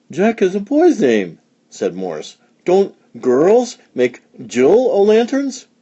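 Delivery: light, high little-creature voice; childlike high voice. A narrator's reading. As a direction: chirp it high and curious. Morris voiced by reader.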